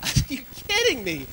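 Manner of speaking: high, loud mock disbelief; shocked. Father retelling his shock at his son's outburst. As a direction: act stunned and outraged. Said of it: "And I was like".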